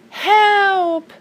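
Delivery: high, loud storybook cry for help; calling for help. A narrator's reading. Mule stuck in a hole, calling out for help. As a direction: call up out of the hole, high and drawn out.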